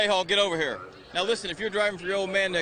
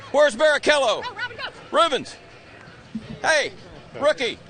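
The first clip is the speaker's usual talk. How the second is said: high, loud hollering for someone; calling out; 6 semitones higher, 3 dB louder than usual. Real, not acted. Reporter hunts for Barrichello on the grid, calling out. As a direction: shout around to find him.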